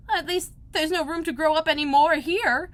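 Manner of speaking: put-on a girl complaining lightly; Alice's voice. A narrator's reading. Alice complaining to herself, lightly girlish.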